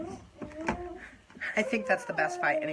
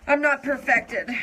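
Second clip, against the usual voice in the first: louder, slower mock declaration; higher, drawn-out joking claim; playful emphatic; 8 semitones higher, 8 dB louder, slower than usual. Real, not acted. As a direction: proclaim it with a wink. Joking about flaws.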